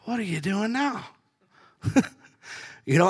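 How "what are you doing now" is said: God's teasing question. Acted. God to someone who jumped ahead, high teasing singsong. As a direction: playfully needle someone who rushed ahead. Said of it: "God's just sitting there going".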